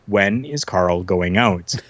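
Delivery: very slow, word-by-word retold question; deliberate insistence. The Patriot asking the promoter a third time, slow and deliberate. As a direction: space out each word, pointedly.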